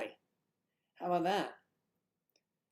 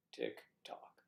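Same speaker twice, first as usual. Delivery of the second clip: spooky breathy whisper of tick-tock; 13 semitones lower, 9 dB quieter than usual. Pretend. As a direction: hiss the ticking like a doom warning. Spooky end-of-world line.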